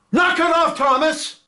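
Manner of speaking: high sharp shout to stop the fight. Acted. Crewman shouting at Thomas to stop the fight. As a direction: yell to break it up.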